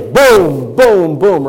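loud, drawn-out falling booms; booming bell imitation. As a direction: toll out each boom like a big bell. Imitating a big swinging bell.

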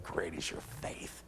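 Jesus softly praising the woman's faith; hushed whisper. Retold or imitated. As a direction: breathe the words gently. Jesus answers the woman tenderly.

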